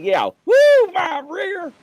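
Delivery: very high sarcastic chief voice; imitating the angry chief; mock sarcastic chief. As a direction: echo his woo with scorn. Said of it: "He's like".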